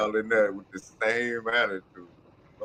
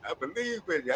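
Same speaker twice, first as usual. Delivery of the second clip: normal talking voice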